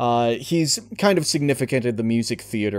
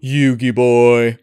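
slow, louder, smug drawn-out greeting; Pegasus impression; 7 dB louder, slower than usual. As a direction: purr the name slowly and smugly, a touch of Pegasus. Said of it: "the voice of, uh, Maximilian Pegasus".

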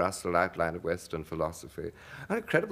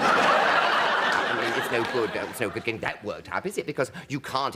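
usual speaking voice

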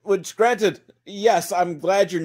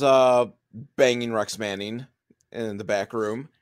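normal talking voice

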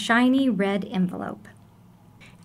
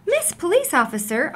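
high surprised greeting; lightly exclaimed welcome to the officer; exclaiming; 5 semitones higher than usual. Acted. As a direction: sing out her name in surprise. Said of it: "Amu exclaimed".

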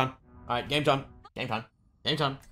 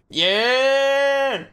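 long, high, loud whoop; excited cheer; 11 semitones higher, 6 dB louder than usual. Real, not acted. Streamer hyped for game time, long loud cheer. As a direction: stretch it out as a big high cheer.

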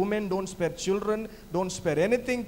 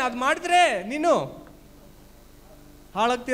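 higher, louder, fast voice of God warning; stern warning; 4 semitones higher, 6 dB louder, much faster than usual. Acted. Pastor voicing God warning a sinner, loud and fast. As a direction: bark the warning down from above.

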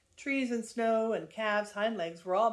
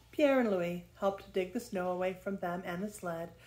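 plain reading voice

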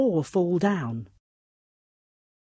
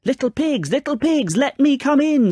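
high, raised storybook wolf demand; snarling wolf; 9 semitones higher, 6 dB louder than usual. A narrator's reading. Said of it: "snarled the wolf".

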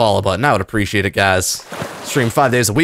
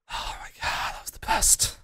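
breathless unvoiced whisper; 10 semitones higher, 14 dB quieter than usual. Real, not acted. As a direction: whisper it in awe. Breathless whisper after win.

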